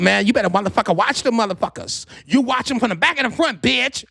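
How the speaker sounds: lively mimicry of T T; imitating cousin T T. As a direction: warn him high and insistent. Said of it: "This is how T T talk".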